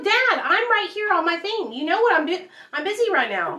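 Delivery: put-on high, put-out kid voice brushing Dad off; mock annoyed kid. Talking to her son, playing herself as an annoyed teen brushing off her dad. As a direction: whine it like a busy kid.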